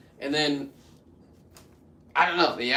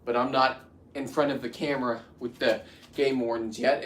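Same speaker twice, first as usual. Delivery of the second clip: usual speaking voice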